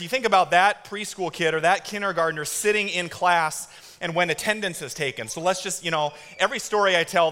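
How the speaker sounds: normal talking voice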